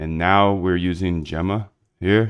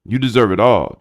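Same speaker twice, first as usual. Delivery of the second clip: louder and higher, hyped shout; loud hype; 4 semitones higher, 9 dB louder than usual. Real, not acted. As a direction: cheer a friend on at full voice. Same line belted out.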